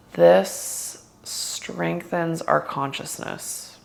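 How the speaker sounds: guided meditation. Acted. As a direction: ease them gently into stillness.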